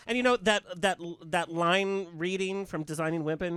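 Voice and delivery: ordinary talk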